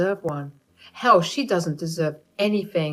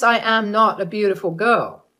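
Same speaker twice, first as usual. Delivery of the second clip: ordinary delivery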